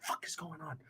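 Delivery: hushed whisper of confusion; whispered disbelief. Retold or imitated. Sneaking out of bed to check the bet, whispering. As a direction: whisper it so the wife won't hear. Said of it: "I'm like".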